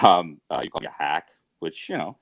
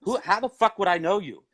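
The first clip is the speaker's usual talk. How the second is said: high-pitched, incredulous indignation; indignant challenge; 10 semitones higher than usual. Real, not acted. Woman or man challenging an accuser who claims to know them, indignant. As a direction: throw it back at them, pitched well up.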